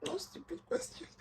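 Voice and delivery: very soft, voiceless whisper; whispered aside. Real, not acted. Vlogger repeating her gripe about assessments, whispered aside.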